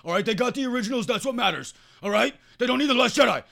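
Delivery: high, loud mocking fanboy voice; whiny man-child defending the originals; mocking superfan. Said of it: "a lot of people are like".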